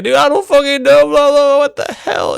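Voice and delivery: very high, loud mock-desperate yelling; panicked shriek. Mateo beaten for information, shrieked mock panic. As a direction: plead at the top of your voice. Mateo acted under a beating.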